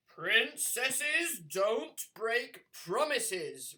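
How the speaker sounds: slow, loud booming king; bellowing king. Acted. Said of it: "repeated the king".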